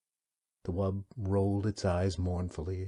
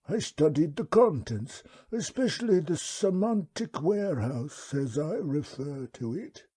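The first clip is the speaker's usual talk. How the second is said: wub's voice; 9 semitones higher, 4 dB louder than usual. Acted. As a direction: speak as a placid, courteous old beast. The wub speaks.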